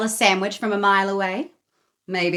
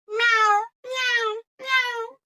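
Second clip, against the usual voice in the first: demanding cat meow; much slower than usual. A narrator's reading. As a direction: cry like a hungry cat. Cat cries again.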